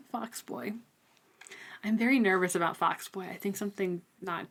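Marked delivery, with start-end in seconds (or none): none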